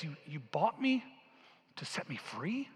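soft, halting, unsure question; disbelieving question. Acted. Freed woman asking her rescuer, quiet disbelief. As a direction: ask it as if hardly daring to believe. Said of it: "she dares to believe it".

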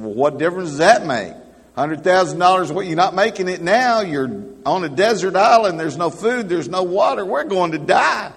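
fast, high, loud frantic outburst; castaway yelling at his friend, fast and frantic; exasperated shouting. Retold or imitated. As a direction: blurt it out in disbelief and panic. Panicked castaway shouts at calm friend.

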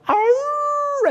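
long, high, loud mock howl; imitated dog howl. Pastor prompting his dog to howl, high mock howl. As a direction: howl like a dog to get one started. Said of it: "He would howl on command".

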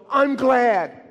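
high, loud burst of gladness; a joyful shout of gladness; glad exclaim. Retold or imitated. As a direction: cry out I'm glad, delighted. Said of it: "and they go".

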